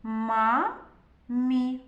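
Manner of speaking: ма, pause, мі; мамі read by syllables. Shown as a demonstration. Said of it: "Та прочитаємо слово".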